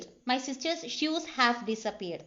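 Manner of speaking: ordinary talk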